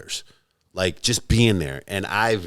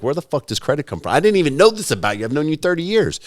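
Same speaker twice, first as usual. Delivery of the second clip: incredulous; 8 semitones higher, faster than usual. Retold or imitated. Telling his friend of thirty years he's baffled, incredulous. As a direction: retell being floored by an old friend. Retold disbelief at old friend.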